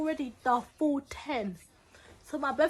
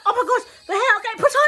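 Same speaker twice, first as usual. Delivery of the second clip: excited; 8 semitones higher, 9 dB louder, much faster than usual. Retold or imitated. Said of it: "And I was just like".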